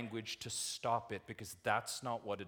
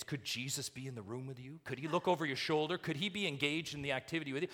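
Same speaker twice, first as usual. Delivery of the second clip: put-on quick, higher-pitched questions to kids; mock Sunday school teacher; 6 semitones higher, 4 dB louder, faster than usual. Preacher imitates what they tell the kids, quick teacher voice. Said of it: "What do you tell the kids?".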